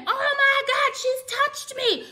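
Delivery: very high, drawn-out mock shriek; mock scream. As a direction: mimic his overblown outcry, way up high. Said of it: "Now he starts screaming".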